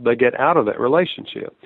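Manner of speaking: usual speaking voice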